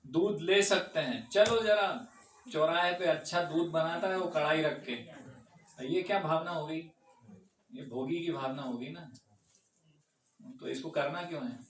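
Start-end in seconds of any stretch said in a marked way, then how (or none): none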